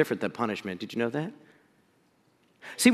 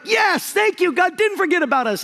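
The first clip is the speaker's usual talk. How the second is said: relieved joy; 11 semitones higher than usual. Retold or imitated. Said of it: "they're like".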